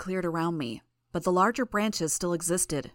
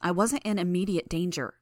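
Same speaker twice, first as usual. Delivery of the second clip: ordinary delivery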